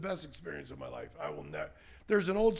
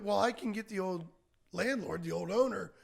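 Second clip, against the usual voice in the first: louder, higher, insistent pitch; earnest argument; 5 semitones higher, 6 dB louder than usual. Retold or imitated. Arguing with the bank for proof, insistent and loud. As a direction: make the case to the lender. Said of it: "I said".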